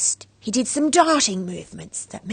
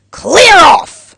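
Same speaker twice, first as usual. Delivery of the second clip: loud, high yell; shouting; 8 semitones higher, 16 dB louder than usual. A narrator's reading. Grumpy neighbour chasing the girl out, shouting. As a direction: drive her out of the room.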